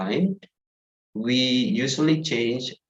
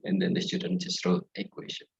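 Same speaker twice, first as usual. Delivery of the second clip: amused whisper; 8 semitones higher, 5 dB quieter, faster than usual. Real, not acted.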